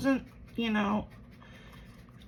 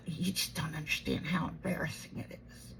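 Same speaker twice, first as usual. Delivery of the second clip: hushed, softer whisper; 5 semitones lower, 10 dB quieter than usual. Retold or imitated. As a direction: breathe it out secretly. Mom's reply, voiced retold.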